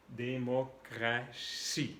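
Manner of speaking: "Democracia" in French, very slow, dé-mo-cra-sie; drawn-out teaching pronunciation of "Democracia", last syllable "see". Shown as a demonstration. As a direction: sound out each syllable of "Democracia" as a French word.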